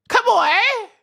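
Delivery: high, loud shout of a name; calling out. Real, not acted. Greeting a chatter who just arrived, loud high call. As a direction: sing out the name in delight.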